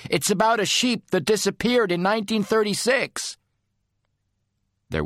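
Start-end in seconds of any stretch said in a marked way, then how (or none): 0.1-3.4 calling through a door. Acted.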